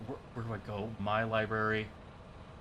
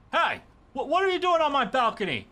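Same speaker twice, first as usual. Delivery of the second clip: high, indignant yell at an intruder; startled challenge; 13 semitones higher, 4 dB louder than usual. Acted. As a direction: demand answers, quick and high-pitched. Acted confrontation with balcony intruder.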